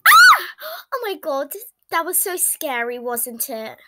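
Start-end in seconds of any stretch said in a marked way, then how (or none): 0.1-0.5 scream. Pretend. Scream in roller coaster ride.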